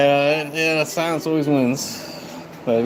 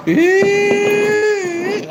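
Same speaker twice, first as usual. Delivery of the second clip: howled yeah; 3 dB louder than usual. Real, not acted. Drawn-out outburst mid-stream.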